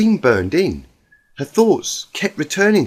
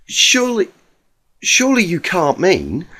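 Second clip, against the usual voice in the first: Rarity, disbelieving; 5 semitones higher than usual. Acted.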